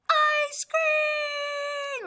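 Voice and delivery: high, loud excited shout. Acted. Gerald shouting about ice cream in the story, loud and high. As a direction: shout it like it's the best news ever. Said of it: "I wanna make it".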